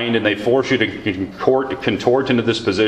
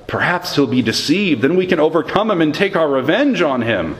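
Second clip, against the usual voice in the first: higher, impassioned reading of the lament; lamenting scripture reading; 6 semitones higher than usual. Acted. Pastor reads Jeremiah's private lament to the Lord, impassioned. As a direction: pour out the complaint as you read.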